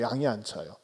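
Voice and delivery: ordinary talk, nothing marked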